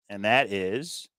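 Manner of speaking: ordinary talk, nothing marked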